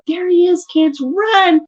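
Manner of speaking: put-on rally the kids to run; calling out to kids. Calls kids to run around house.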